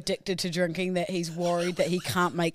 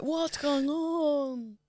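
very slow, high, eerie moan; drawn-out Dracula wail; 8 semitones higher, 4 dB quieter, much slower than usual. Retold or imitated. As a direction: stretch every word out in a high, dazed wail. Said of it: "you turned into a fucking Dracula".

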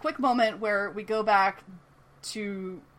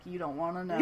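jaded noir detective; 2 semitones lower, 4 dB quieter, faster than usual. Retold or imitated. Audrey strolling through the scene, lightly voiced jaded detective. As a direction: toss it off like Chinatown Jake. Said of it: "like some sort of noir detective".

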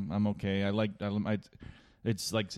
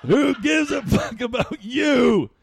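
high, slow laughing yell; mock shout; 7 dB louder, slower than usual. Comic retelling yelling at the realtor, laughing shout.

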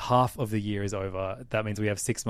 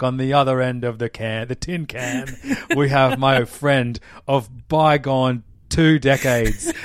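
hammed-up British accent, louder, laughing through it; 3 semitones higher, 9 dB louder than usual. Host opening the show in a mock British accent on request. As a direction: ham up a chirpy British podcast intro. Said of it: "keep the accent up".

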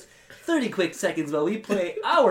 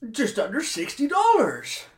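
higher, louder eager amazement; excited infomercial customer; 7 semitones higher, 5 dB louder than usual. Acted. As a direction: sound thrilled at the price.

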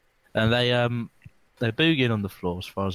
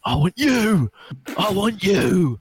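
imitating a furious drunk, higher and louder; ballistic shout; 7 semitones higher, 5 dB louder, much slower than usual. Retelling the small rowdy mate losing it, mock furious yell. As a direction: bellow it like a man gone mental at a club. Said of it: "he turned into to Nutty Man, and he just went ba-, ballistic".

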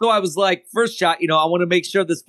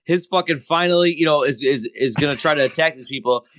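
ordinary talk, nothing marked

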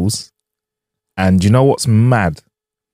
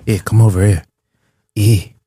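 put-on Scottish accent. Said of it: "Give me your best Scottish detective".

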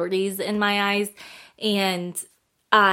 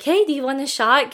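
higher, louder retold invitation; eager approach; 6 semitones higher, 5 dB louder than usual. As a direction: replay an excited fan's pitch, lightly acted.

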